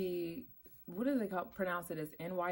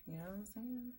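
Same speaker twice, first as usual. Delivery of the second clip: soft whispered check-in; hushed whisper; 3 semitones higher, 11 dB quieter than usual. Pretend. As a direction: whisper along to keep the silence. Whispering along, must be dead silent.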